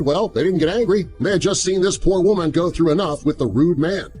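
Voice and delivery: plain reading voice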